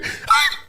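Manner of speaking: brief, sharp yelp; imitated dog yelp. Host showing a dog pinned by its paws, sharp yelp.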